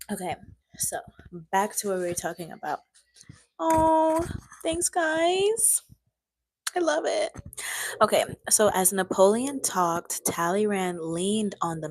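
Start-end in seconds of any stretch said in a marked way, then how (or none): 3.6-7.3 high, sing-song thanks; bright mock-gushing thank you; high squeal of thanks. Real, not acted.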